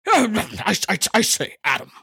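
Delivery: hammed-up spluttering British gent; jaunty British man. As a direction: overdo a fussy British accent. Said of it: "jaunty British man".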